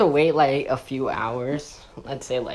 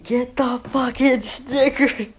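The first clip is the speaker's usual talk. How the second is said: desperate cop; 8 semitones higher than usual. Retold or imitated. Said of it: "And he's like".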